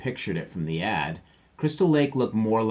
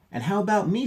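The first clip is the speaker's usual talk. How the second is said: Sister; 12 semitones higher, 5 dB louder, faster than usual. Acted. Voicing Sister, higher.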